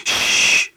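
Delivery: sharp, drawn-out shushing; urgent whispered shush; whispered hush. A narrator's reading. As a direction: hush them with a long shh. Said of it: "hviskede Tulle".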